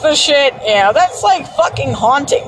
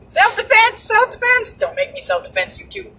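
anime line quote; 10 semitones higher, 4 dB quieter than usual. Retold or imitated. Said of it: "my absolute favorite freaking line".

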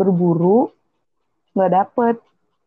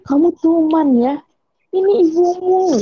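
high-pitched mock scolding mother; Dayang Sumbi; 8 semitones higher than usual. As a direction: act out the mother's reveal, pitched up. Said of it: "Dan Sumi".

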